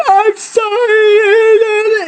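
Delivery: very high, long drawn-out wail; mock panicked, wailing apology; freaking-out apology. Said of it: "she starts freaking out".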